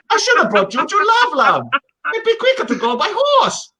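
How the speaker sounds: high-pitched retold complaint; mock exasperation. Wrestler retelling his gripe after bus trip, mock exasperated. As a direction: climb high in comic exasperation. Quotes own exasperated reply.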